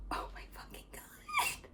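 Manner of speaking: terrified whisper to herself; panicked whisper. Retold or imitated. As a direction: squeal it in a breathless whisper. Panic when dad knows.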